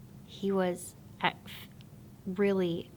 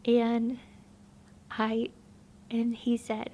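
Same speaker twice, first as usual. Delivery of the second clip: higher, emotion-choked testimony voice; tearful; 4 semitones higher than usual. Real, not acted. Telling how God showed her the past, tearful. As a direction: push through tears to finish the story.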